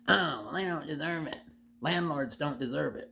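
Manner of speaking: high mocking whiny voice; mocking opponents. Ranting host mimicking his critics, a high mocking whine. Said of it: "especially the Kool-Aid people".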